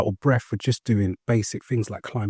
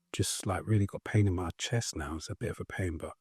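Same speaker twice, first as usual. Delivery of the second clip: much softer, understated complaint of pain; subdued admission; 12 dB quieter than usual. Retold or imitated. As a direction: quietly admit you're hurting, retold. Retelling telling colleagues of chest pain.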